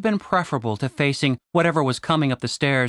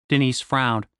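ordinary delivery

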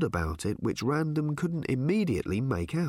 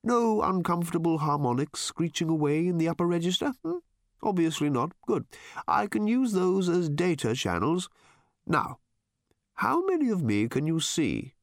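higher, pleasant tenor bird; light-tenor bird creature; 7 semitones higher than usual. A narrator's reading. Bird calibrating itself for Random, light tenor. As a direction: keep the bird's voice up in a light tenor.

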